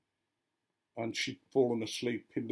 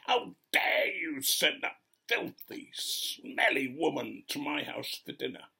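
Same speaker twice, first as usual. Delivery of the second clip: slow, higher-pitched mock fury, harsh and breathy; incandescent rage; 4 semitones higher than usual. Churchgoer accusing vicar over dinner guest, imitated as fuming. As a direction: act out seething outrage, word by word. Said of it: "She was incandescent with rage".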